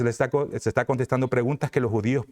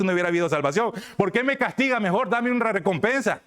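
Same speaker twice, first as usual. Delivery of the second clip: Judas Iscariote; 4 semitones higher than usual. Acted. Preacher plays Judas arguing with Jesus on judgment day, self-justifying. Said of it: "Es como que dijera Judas Iscariote".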